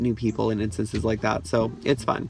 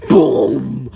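imitated boom; 9 dB louder than usual. Elevator bangs into them.

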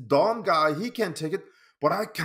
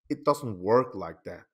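ordinary talk

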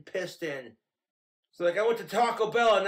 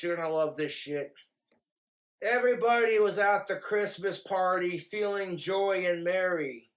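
ordinary talk

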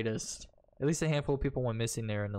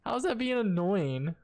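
mock indignant; 11 semitones higher than usual. Indignant reply to NPC calling him annoying.

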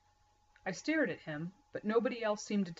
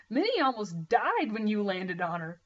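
choked laughter; 3 semitones higher, 5 dB louder than usual. A narrator's reading. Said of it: "he choked back, trying to talk".

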